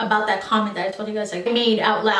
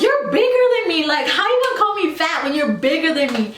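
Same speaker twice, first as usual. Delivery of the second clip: yelling back; 6 semitones higher than usual. Retold or imitated. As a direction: fire it straight back in her face. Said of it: "It was just, like, in my face".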